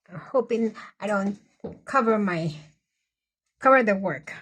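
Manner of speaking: normal talking voice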